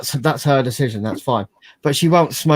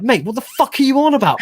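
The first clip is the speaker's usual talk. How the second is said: retold outburst; 12 semitones higher, 3 dB louder than usual. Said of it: "you were like".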